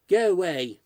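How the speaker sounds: put-on boy yelling at the ghost to leave. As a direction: shout him off. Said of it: "yelled Simon".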